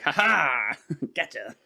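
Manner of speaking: mocking voice gloating playfully; taunting brain voice. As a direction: tease like a prankster. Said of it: "Your brain's like".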